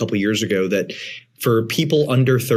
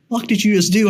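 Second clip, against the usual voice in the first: high, incredulous whisper-free disbelief; shocked wife; 10 semitones higher, faster than usual. Retold or imitated. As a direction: play the stunned wife's look. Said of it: "Reagan just looked at me like".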